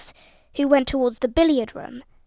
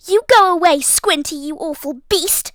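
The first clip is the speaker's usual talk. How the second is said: loud furious shout; 3 semitones higher, 5 dB louder than usual. A narrator's reading. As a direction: hurl it at her brother. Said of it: "Daisy shouted".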